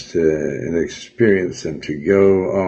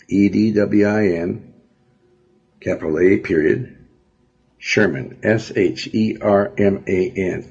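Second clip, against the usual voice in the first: spelling it out letter by letter; dictating. Real, not acted. Spelling out author's name.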